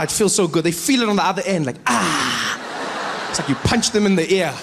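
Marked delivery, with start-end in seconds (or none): none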